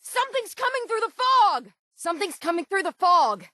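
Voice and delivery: high, urgent shout of warning; alarmed call. Acted. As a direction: yell it out to warn everyone the fog is hiding danger. Warning shout at approaching danger.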